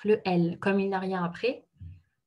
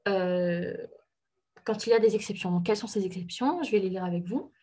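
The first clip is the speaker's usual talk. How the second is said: usual speaking voice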